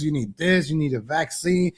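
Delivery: ordinary talk, nothing marked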